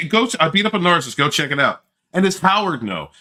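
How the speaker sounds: ordinary talk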